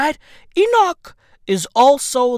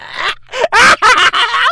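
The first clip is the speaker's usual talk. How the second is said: a shrieking laugh, much higher and louder; high burst of laughter; 12 semitones higher, 6 dB louder than usual. Real, not acted. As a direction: explode into a howling laugh. Cracks up at the title.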